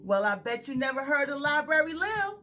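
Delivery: usual speaking voice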